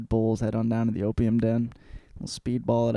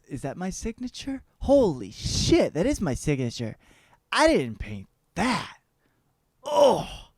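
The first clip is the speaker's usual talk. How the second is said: horrified disbelief; 7 semitones higher than usual. Acted. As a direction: exclaim it in rising dismay. Painter recognizes his signature, horrified.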